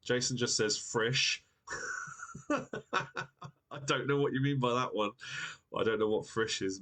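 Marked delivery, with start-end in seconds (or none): 1.8-5.1 laughing through the words, drawn out; real amused laugh carrying into the line; baffled and laughing. Real, not acted. Amused by a viewer's nonsense word.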